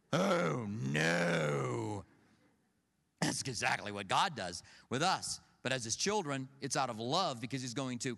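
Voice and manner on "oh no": long, drawn-out mock-dread groan; hammed-up child in trouble; acted dread. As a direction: draw out each word in exaggerated dread. Dreading dad's threatened punishment at home.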